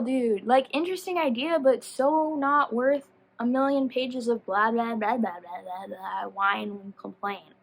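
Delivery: usual speaking voice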